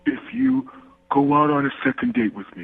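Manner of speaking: bargaining in a goofy cartoon voice; put-on cartoon dimwit; Patrick. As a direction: plead as a dopey cartoon starfish. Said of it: "I'll stop doing the voice".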